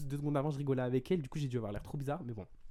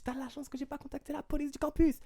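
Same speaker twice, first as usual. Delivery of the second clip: very high-pitched retold threat; indignant warning; 13 semitones higher than usual. Telling her she's lucky about campus police, indignant warning. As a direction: act out scolding her in a high, agitated voice. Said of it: "j'étais trop énervé".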